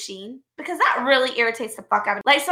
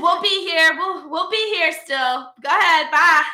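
higher, loud singsong dismissal; taunting send-off; 4 semitones higher, 3 dB louder than usual. Retold or imitated. Staff send off customer threatening another store, taunting imitation. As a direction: call after them with mock cheer.

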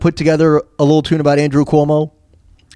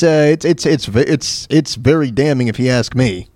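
ordinary talk, nothing marked

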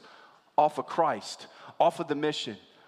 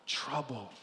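dropped much softer, low and heavy; dramatic hush; 6 semitones lower, 11 dB quieter than usual. Acted. Pastor completing Jesus' words, hushed and heavy. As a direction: let the word fall like a weight. Answering his own question dramatically.

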